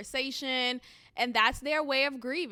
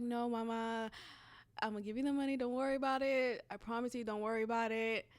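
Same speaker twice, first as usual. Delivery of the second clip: much softer, gentle and tender; tender reassurance; 6 dB quieter, faster than usual. Retold or imitated. Cousin insisting she'll pay, soft and tender. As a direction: soothe her softly, like a caretaker. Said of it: "she was like".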